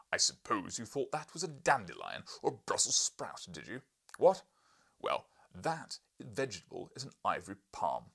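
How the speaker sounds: loud, scornful hectoring; exasperated lecture. Acted. Professor hectoring the narrator over the sketch. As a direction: hammer the point home.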